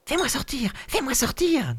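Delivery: very high, repeated little cry; tiny spirit voice. Acted. Spirit in the bottle begging the boy, very high squeak. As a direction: squeak it over and over. Frog-like thing in bottle cries.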